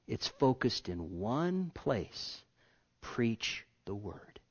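slow, soft emphasis; quiet, measured conviction; 1 hushed, slow. Real, not acted. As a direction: say it softly and deliberately.

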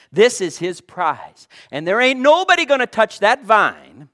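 loud preaching cry. Real, not acted. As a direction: proclaim it to the room. Preaching at full force.

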